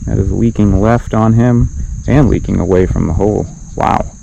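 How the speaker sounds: broadcaster describing a missed putt; golf commentator. Pretend. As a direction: narrate the miss like TV golf. Golf play-by-play on shot.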